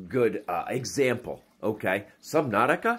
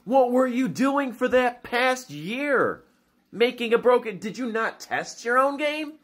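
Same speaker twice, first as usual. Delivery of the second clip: asking the game makers, pitched way up and slowed in outrage; exasperated disbelief; 12 semitones higher than usual. Acted. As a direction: drag it out in stunned disbelief. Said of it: "It's just like".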